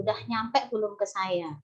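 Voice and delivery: normal talking voice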